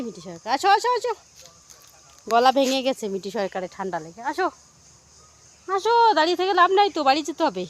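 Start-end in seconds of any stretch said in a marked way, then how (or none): 0.5-1.1 calling out. Real, not acted. Mother calls her dawdling daughter in the forest, high calling voice. 4.3-7.7 high, quick coaxing call; urging call. Real, not acted. Mother urges daughter to head home, quick high coaxing call.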